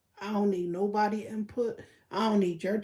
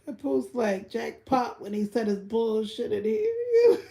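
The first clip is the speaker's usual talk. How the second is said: words spilling out through laughter; laughing; slower than usual. Real, not acted. Vlogger laughing at what [name] said, laughing through. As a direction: laugh through it, barely holding on.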